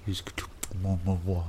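put-on baby talk with kissy noises; talking to a dog. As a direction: smooch the puppy while cooing. Kissing the puppy.

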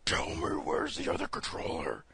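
overdone raspy superhero interrogation; garbled hoarse mock yell, rising at the end; Batman. Retelling the big battle in Gotham, mock raspy yell. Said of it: "it's just the way he's yelling at".

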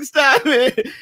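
high, wailed plea; mock crying protest. Storyteller as his crying self on the desk, wailed protest. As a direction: wail it like a bawling kid. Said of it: "I'm totally crying my brains out".

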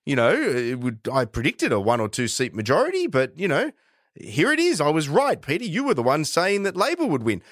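high-pitched, smug mocking impersonation; Christopher Pyne. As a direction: mimic a politician crowing that he was right. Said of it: "he starts mocking me for being wrong".

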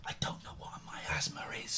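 hushed off-mic whisper. Real, not acted. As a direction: whisper it so the caller can't hear. Private chat with mics muted.

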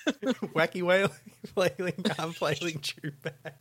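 high, giggling read through the phrase; can't keep a straight face on wacky wailing; a giggly, high mock-serious read; laughing while reading. Real, not acted.

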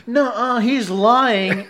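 high, loud mock-panicked protest; protesting denial. Accused traveler telling bystanders his friend lies, high protesting denial.

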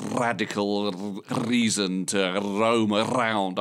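slow, every r in "Radical" and "roam" rolled hard; over-roll each r in "reason to roam around", slowly; hammed-up vocal warm-up with every r rolled; rolled r's. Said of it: "in, like, completely ridiculous way".

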